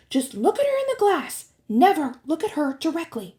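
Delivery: put-on high, emphatic girl's voice; high-pitched warning; instructing young girl. A narrator's reading. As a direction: give urgent, firm instructions. Annabeth voiced giving urgent instructions.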